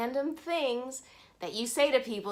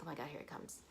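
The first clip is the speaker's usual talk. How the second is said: panicked whisper; 5 semitones lower, 15 dB quieter, faster than usual. Retold or imitated. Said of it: "I was starting to really reel".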